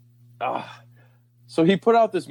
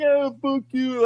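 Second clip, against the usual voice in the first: mock snippy retort in a much higher voice; dismissive retort; 9 semitones higher, slower than usual. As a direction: toss it off, sneering and high. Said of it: "I'm like".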